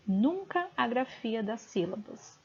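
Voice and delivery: ordinary talk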